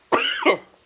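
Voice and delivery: harsh hacking cough; coughing fit. Real, not acted. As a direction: cough hard, out of control. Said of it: "went down the wrong pipe".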